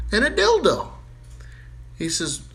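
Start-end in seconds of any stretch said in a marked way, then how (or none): 0.1-0.9 high, loud squeak; squeaky punchline. Acted. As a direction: pop it up in a squeal.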